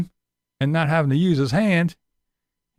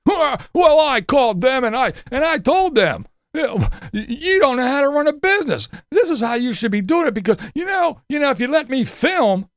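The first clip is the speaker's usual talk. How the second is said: high, loud ranting parody of Tater; a hammed-up furious Tater blowing up at a business; mock angry Tater; 8 semitones higher, 4 dB louder than usual. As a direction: rant like a self-important big-time YouTuber, higher and louder. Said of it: "That's how Tater was".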